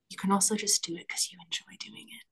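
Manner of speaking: soft quick whisper. Real, not acted. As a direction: drop to a hushed whisper. Soft aside about enjoying things.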